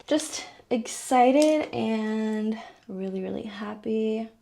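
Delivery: usual speaking voice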